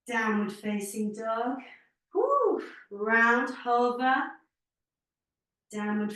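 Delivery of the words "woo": very high, airy whoop of effort; quick real whew of exertion; exertion woo. Real, not acted. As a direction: puff out a high "woo" mid-workout. Effort during repeated poses.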